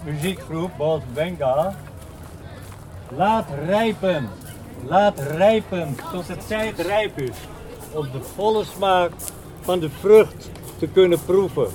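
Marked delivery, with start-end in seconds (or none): 3.1-11.7 measured lyric reading with spaced phrases; poem recitation. Acted. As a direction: linger on the opening words, then pause between phrases. Recites a translated song lyric.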